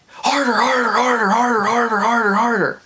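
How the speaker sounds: high, loud repeated screaming; retold scream. Date's father screaming in the dog park, loud high yell. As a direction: scream it out at the top of your voice. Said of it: "he starts screaming".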